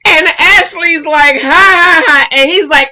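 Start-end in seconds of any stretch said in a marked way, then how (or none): 1.4-2.3 loud, high mock "ha ha ha"; imitated laugh. Retelling Ashley laughing at Hollywood.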